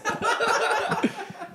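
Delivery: breathy burst of laughter. Real, not acted. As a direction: laugh off the story. Laughing at the memory.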